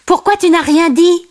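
high, insistent character question; Timon. A narrator's reading. Timon asks why Simba said nothing, high voice. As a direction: ask it high in a sidekick voice. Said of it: "demanda Timon".

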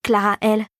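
plain reading voice

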